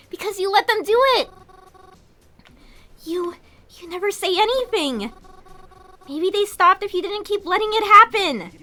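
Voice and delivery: hurt accusation. Acted. Mary accusing Kroven of letting it happen, hurt and angry. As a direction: blame him, voice rising.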